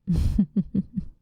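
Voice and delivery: airy, rippling little laugh; hushed string of chuckles; breathy chuckle. Acted. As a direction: chuckle under your breath in short puffs. Quiet laugh before kiss question.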